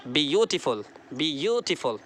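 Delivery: Beautiful with यू drawn out; stress on BYU. Shown as a demonstration. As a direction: stretch the यू in beautiful, then fall away. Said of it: "देखिए सुनिए मेरी बात".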